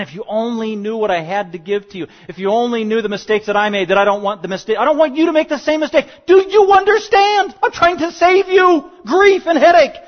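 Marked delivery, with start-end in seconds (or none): 4.8-10.0 loud, high desperate plea; pleading parent. Retold or imitated. Pastor voicing pleading parent.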